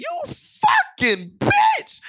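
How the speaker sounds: very high, slow mock scream; drawn-out hammed-up shriek; mock cursing out. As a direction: scream it like an over-the-top curse. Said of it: "in that tone of voice".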